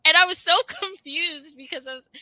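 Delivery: laughing through the words, high; a real laugh breaking into the story. Real, not acted. Retelling her confusion, laughing.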